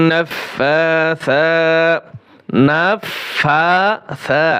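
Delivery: নাফাসা very slow, with a long ফা stretched by one alif; recitation with madd. Shown as a demonstration. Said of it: "এক আলিফ টান হবে". (এক আলিফ টেনে তেলাওয়াত)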